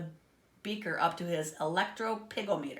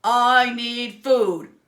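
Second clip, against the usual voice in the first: very loud, very slow monster pig bellow; giant pig shout; 5 semitones higher, 13 dB louder, much slower than usual. A narrator's reading. Giant pig demanding food, slow roaring bellow. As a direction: bellow your hunger like a giant beast. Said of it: "the giant pig shouted".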